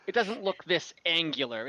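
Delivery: normal talking voice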